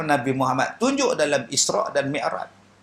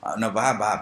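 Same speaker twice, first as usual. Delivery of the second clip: ordinary talk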